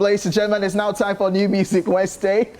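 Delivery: upbeat radio host voice. Acted. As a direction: hype up the weekly playlist. Presents the music segment.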